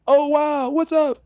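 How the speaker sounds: high-pitched retold "oh wow"; mock surprised greeting. Actor greeting the director on set, high mock surprise.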